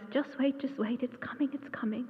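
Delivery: soft, hushed mutter, nearly whispered; imitating an old friend's quiet fussing. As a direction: mutter it under your breath to impatient birds. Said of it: "as he mutters".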